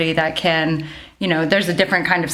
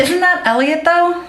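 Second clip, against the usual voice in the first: surprised high question; 11 semitones higher, 4 dB louder than usual. Real, not acted. Surprised question about characters.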